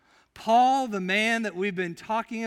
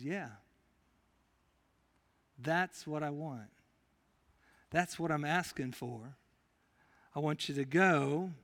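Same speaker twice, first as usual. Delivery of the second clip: much lower and softer, calm and unhurried; God's gentle reply; 5 semitones lower, 5 dB quieter than usual. Acted. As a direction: speak as God, quietly reassuring a frightened servant. Preacher voices God's reply.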